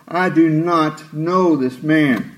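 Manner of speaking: each word slow and deliberate; slow, emphatic mock oath; slow sworn denial. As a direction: spell it out like an oath. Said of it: "as he starts to swear, calling down a curse".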